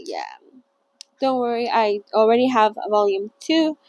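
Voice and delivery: usual speaking voice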